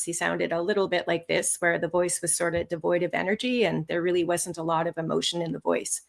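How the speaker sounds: low, flat monotone drained of energy. Shown as a demonstration. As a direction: speak on one note, softly, with no emotion. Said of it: "I'm going to demonstrate right now".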